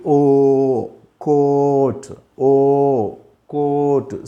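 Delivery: slow teacher's model of ఓ and coat; drawn-out demonstration. Shown as a demonstration. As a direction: stretch the long O in coat, slow and level. Teacher models long vowel sound.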